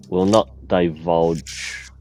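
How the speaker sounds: normal talking voice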